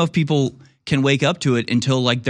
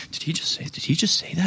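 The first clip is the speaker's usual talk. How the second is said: whispered, higher, scandalized; shocked whisper; 4 semitones higher, 6 dB quieter than usual. Retold or imitated. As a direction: hiss it to your neighbour in disbelief. Crowd whispering after offensive remark.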